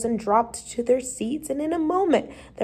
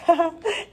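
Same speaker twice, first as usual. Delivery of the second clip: short, high storyteller's laugh; quick put-on chuckle; acted laugh; 6 semitones higher than usual. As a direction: laugh briefly as the pupils do. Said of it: "everybody else and laughed".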